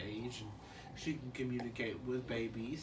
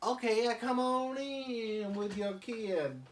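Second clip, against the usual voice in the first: higher slow reluctant dad; Stu; 7 semitones higher, 4 dB louder than usual. Acted. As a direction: sigh it out slowly. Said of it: "he's like".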